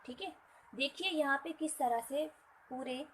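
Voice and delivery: ordinary talk, nothing marked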